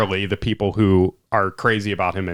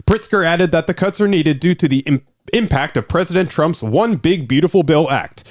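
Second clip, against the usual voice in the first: higher-pitched, projected news read; newsman voice; 6 semitones higher than usual. Pretend. Co-host reads the Pritzker budget story on request, newsman voice. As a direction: read it out like a wire-copy newscaster. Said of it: "your newsman voice".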